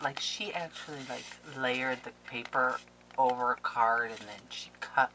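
ordinary talk, nothing marked

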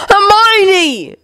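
high, anguished scream, falling off at the end; screamed name. A narrator's reading. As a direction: scream for her in desperation. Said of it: "Ron screamed again".

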